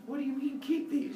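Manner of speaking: high, put-on creature question; puzzled young Cyclops. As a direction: pipe up high, confused by what you overheard.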